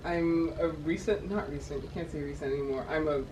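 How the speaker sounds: normal talking voice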